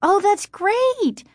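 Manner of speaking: high, drawn-out delighted cry; overjoyed exclamation. A narrator's reading. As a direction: exclaim with soaring happiness. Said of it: "Margaret exclaimed, so happy she could almost cry".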